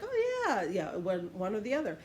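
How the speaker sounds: ordinary talk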